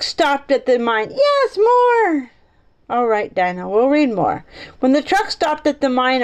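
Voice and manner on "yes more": very high, put-on little voice; high, drawn-out pretend plea; eager listener voice. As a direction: squeal for more of the story. Voices listener begging for more reading.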